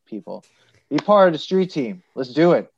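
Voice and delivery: ordinary talk, nothing marked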